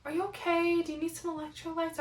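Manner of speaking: high, soft, solicitous voice; imitated concerned onlookers. Onlookers checking on a cramping runner, imitated concern.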